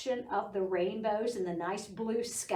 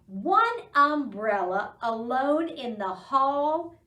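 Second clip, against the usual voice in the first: poem recitation; 4 semitones higher, 6 dB louder than usual. Acted. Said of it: "So the poem goes".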